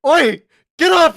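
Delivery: high, loud shout to wake him; yelling at him. Retold or imitated. Shaking the knocked-out rival, yelling loud and high. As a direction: bellow at the downed kid to get up.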